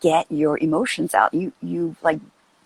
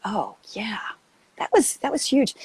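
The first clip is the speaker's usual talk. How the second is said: hushed realization; 4 semitones higher than usual. Retold or imitated. Realizing something inside, hushed.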